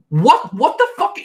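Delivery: high, put-on whiny voice mocking her; mocking Christy. Recapping Big Brother, mocking Christy's complaint in a high whine. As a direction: parody her indignant complaint. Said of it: "she says".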